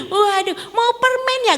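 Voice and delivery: put-on high sing-song offer to a child; exaggerated high voice coaxing a little one; fussing teacher. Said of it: "gurunya misalnya kan bawain permen".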